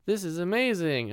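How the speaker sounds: children waking to toys, mock excited; excited kids. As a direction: gasp at the presents like a kid. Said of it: "they're like".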